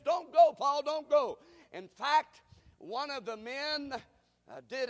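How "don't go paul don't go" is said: loud, high plea; pleading friends. Retold or imitated. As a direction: beg him to stay.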